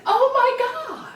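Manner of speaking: high, loud, drawn-out exclamation; imitated delighted amazement. Girlfriend reacting to the coming-out news, high imitated amazement.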